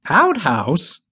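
high rising "Outhouse?"; startled squeak. A narrator's reading. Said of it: "Bitsy squeaked".

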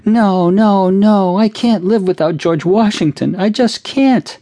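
very high, loud, slow wailing refusal; hysterical sobbing. A narrator's reading. Cindy refusing comfort from her mother, wailing hysterically. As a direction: howl it through hysterical tears.

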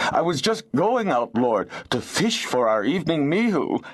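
high, pleading excuse; high-voiced servile protest; wheedling excuse. A narrator's reading. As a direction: plead upward to the lord, turning whiny. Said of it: "His voice changed to a plaintive whine".

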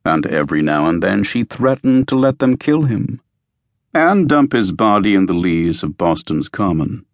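usual speaking voice

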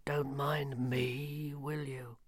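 slow, hushed, peevish reaper; peevish grim reaper. Acted. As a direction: sulk it out slowly as Death. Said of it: "said Death peevishly".

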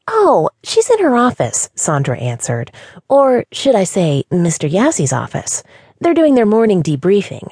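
high, bubbly and breathy; chirpy young office girl; cheery receptionist voice. A narrator's reading. Sandra's bubbly voice.

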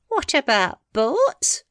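put-on high, slow old woman's query; puzzled, mishearing old wife; deaf old woman. Deaf wife mishearing her husband, high and slow.

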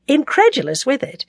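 usual speaking voice

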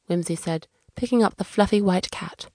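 ordinary delivery, nothing marked

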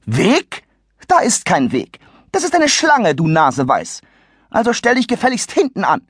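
haughty shrieky matron; indignant old lady. Acted. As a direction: scold the rude youth. Lady scolds the boy.

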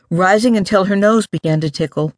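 ordinary delivery, nothing marked

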